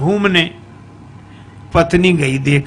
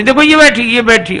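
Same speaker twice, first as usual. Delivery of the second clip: excited accusing; 6 semitones higher than usual. Acted. Bride pointing at the mirror.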